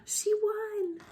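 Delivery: high, soft gasp of amazement; gasp, then marvel quietly; delighted gasp. Real, not acted. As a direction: reader gasping with joy at the ending.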